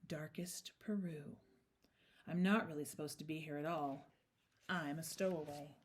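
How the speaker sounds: low, secretive near-whisper; hushed confession. A narrator's reading. The bear admitting he is a stowaway, hushed. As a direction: confide it softly and low. Bear confides after looking around carefully.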